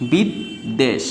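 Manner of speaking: "বিদ্বেষ" split as bid, then desh; slow "বিদ্বেষ" with a gap after bid; split syllables. Shown as a demonstration. Teacher giving an example of the rule, split syllables. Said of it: "উচ্চারণটাই দুইবার হবে".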